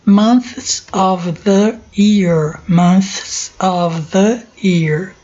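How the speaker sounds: "Months of the year" drawn out, word by word; slow drilled phrase. Shown as a demonstration. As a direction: model it slowly for the class. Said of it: "Tô dando pausa pra vocês pronunciarem". ("Months of the year" bem devagar, para a turma repetir)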